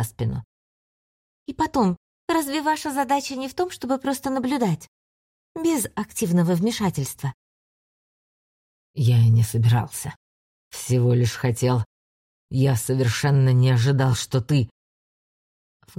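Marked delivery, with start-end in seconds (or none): 1.5-7.3 put-on high, firm girlish voice; a girl standing her ground; young girl voice. 8.9-14.7 low, caught off guard; low flustered voice. Acted. Ander taken aback, low.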